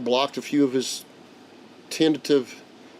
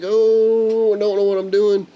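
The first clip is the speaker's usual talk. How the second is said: high, louder, flustered panic; a first-timer suddenly holding the stick; retold panic; 8 semitones higher, 5 dB louder, slower than usual. Grandpa grabbing the stick as the plane drifts, high panicked cry.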